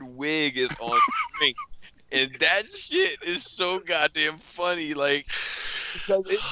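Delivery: high, giggly retelling, cracking up; laughing while talking. Real, not acted. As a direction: talk through real laughter, voice rising. Cracking up while reading the chat.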